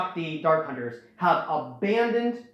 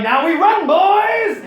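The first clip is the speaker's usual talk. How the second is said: much higher, loud rallying shout; shouted command; 14 semitones higher, 11 dB louder, much slower than usual. Retold or imitated. Leader yelling at the gang to flee, loud shout. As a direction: holler for the crew to bolt. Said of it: "And they're like".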